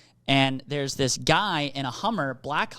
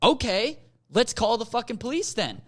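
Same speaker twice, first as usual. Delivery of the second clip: higher-pitched mock-defiant retort; 7 semitones higher than usual. Driver answering a threat to call police, defiant. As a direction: call his bluff, voice raised in pitch. Retold standoff over calling police.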